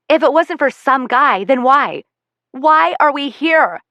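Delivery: angry shout. Acted. Daughter shouting at mother.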